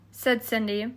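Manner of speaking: ordinary delivery, nothing marked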